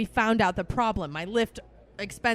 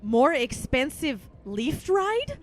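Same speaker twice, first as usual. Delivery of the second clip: very slow questioning more expensive Lyft ride; slow agent voice; 4 semitones higher, much slower than usual. Retold or imitated. Bank agent verifying charges, slow question. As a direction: drag out the question. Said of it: "she literally said".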